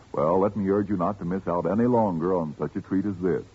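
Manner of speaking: ordinary talk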